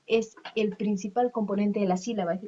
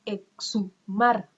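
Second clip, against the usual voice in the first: slow, louder, split "exhumar" into three beats; syllable by syllable; 5 dB louder, much slower than usual. Shown as a demonstration. Teacher breaking "exhumar" into syllables for students, slowly.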